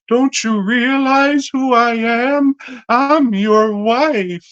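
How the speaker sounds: high, drawn-out mock female voice; hammed-up falsetto, stretching the last words; mummy's dramatic reveal. Mummy revealing she is the wife, high hammed-up voice.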